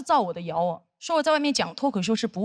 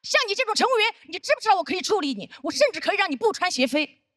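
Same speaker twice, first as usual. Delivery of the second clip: high, loud hammed-up tirade; mock scolding; 9 semitones higher, 3 dB louder, faster than usual. Senior scolding her over flat shoes, hammed-up angry tirade. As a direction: rant like a petty tyrant.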